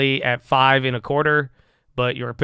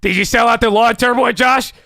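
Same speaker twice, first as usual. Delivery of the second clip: higher and louder, rising anxious viewer voice; panicked viewers; 8 semitones higher, 8 dB louder than usual. Retold or imitated. Streamer imitates anxious viewers pestering him, higher and louder. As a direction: play a nervous fan pestering Josh, climbing up. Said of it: "you're gonna have everybody asking".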